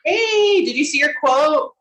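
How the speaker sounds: bright, loud and high hello; excited greeting. Real, not acted. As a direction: greet her with a big burst of excitement. Greeting a colleague who popped in.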